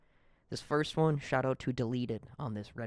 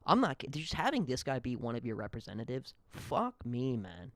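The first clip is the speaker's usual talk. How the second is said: ordinary delivery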